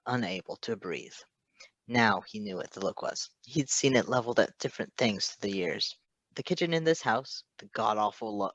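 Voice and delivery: ordinary delivery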